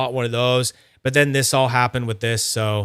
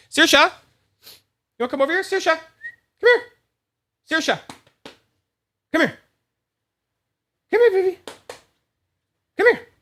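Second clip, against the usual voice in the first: high sing-song call to the dog; faster than usual. Real, not acted. Owner coaxing his dog Saoirse to come over, high sing-song call. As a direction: coax the dog over in a much higher voice.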